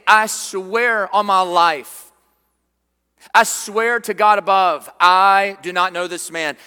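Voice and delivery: loud, slow, insistent oath; desperate swearing. Retold or imitated. Cornered Peter swears to the crowd, loud and slow. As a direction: shout the denial like a cornered man swearing for his life. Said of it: "he's like".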